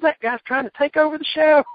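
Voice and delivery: high, loud exasperated impression; Cornette impression. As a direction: squawk it like a cranky manager. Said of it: "He goes".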